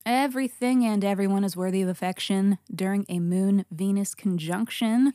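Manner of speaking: ordinary delivery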